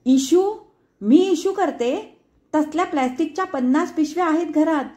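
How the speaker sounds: very high, loud incredulous tirade; outraged mother at full pitch; shrieking at top pitch. A narrator's reading. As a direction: scream it at the top of her voice. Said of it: "पार टिपेच्या सुरात ओरडली".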